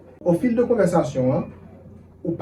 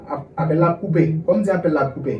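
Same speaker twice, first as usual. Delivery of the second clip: ordinary talk, nothing marked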